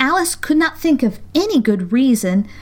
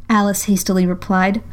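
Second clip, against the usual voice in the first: plain reading voice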